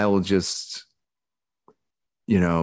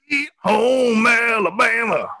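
much higher, slow mock Southern holler; hammed-up Southern; 13 semitones higher, slower than usual. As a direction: belt it like a rowdy Southern fan. Joking about becoming Southern.

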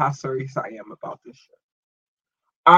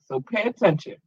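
ordinary talk, nothing marked

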